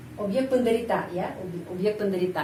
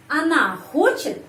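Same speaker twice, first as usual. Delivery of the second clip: хочет lifted high on its first syllable; questioning pitch peak on хочет; question intonation; 5 semitones higher than usual. Shown as a demonstration. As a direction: ask by raising хочет sharply. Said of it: "saya menyusun kalimat tanya".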